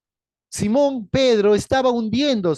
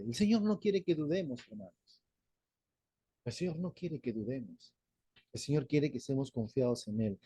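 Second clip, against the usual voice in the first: hushed appeal; 5 semitones lower, 10 dB quieter than usual. Real, not acted. Tender appeal to congregation.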